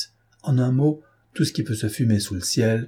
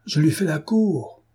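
high, proud toss of the line; a hint of young officer's triumph; triumphant; 7 semitones higher than usual. A narrator's reading. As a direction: crow it out as a winning point. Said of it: "jeta triomphalement".

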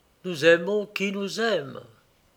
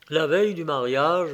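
usual speaking voice

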